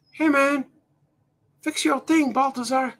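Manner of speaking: teasing sidekick voice ribbing the streamer; heckling sidekick voice. Acted. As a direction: play a pushy pal hassling the host. Heckler voice calls out to host.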